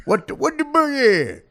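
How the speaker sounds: "boogie" high, loud and stretched; exasperated garbled repeat. Retold or imitated. Woman mad he doesn't understand, "boogie" loud and drawn out. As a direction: mimic her mangled "boogie" at full volume. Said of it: "She's mad at me".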